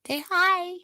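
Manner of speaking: sing-song pet voice; high baby-talk to pets. Acted. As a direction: coax the cat to say hi.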